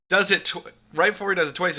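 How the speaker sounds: ordinary talk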